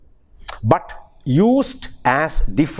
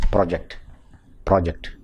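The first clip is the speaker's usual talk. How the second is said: low-pitched, undifferentiated "project"; "project" with no contrast between PRO and JECT; no stress shift; 8 semitones lower, 4 dB quieter than usual. Shown as a demonstration. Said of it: "we tend not to differentiate between these two".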